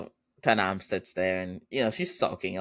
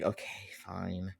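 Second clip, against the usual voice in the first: much softer, slow sulky mumble; 2 semitones lower, 11 dB quieter, slower than usual. Retold or imitated. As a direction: give in grudgingly, under your breath. Sulking girlfriend gives in.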